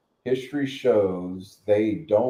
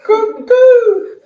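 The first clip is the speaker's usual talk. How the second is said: mock cuckoo laugh; 12 dB louder than usual. Mocking cackle after the rant.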